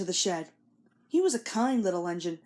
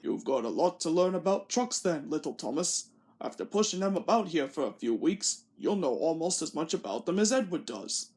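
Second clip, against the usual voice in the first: lightly voiced kindly boss, a touch lower; Fat Controller; 3 semitones lower than usual. A narrator's reading. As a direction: gently lecture the little engine. Fat Controller lecturing Thomas.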